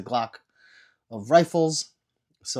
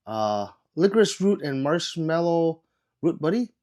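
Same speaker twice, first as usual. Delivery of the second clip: normal talking voice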